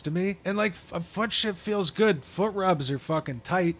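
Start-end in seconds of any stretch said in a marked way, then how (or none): none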